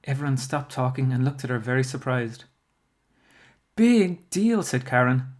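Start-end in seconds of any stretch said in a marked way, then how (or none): 3.8-4.6 loud, high-pitched dismissal; scornful. A narrator's reading. Karen to classmates teasing Bradley, scornful. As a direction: brush them off loudly.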